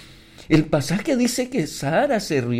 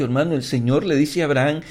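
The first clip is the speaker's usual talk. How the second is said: usual speaking voice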